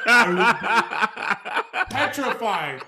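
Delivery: words broken by laughter; laughing. Real, not acted. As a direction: laugh through the line, slow. Laughing at his own bit.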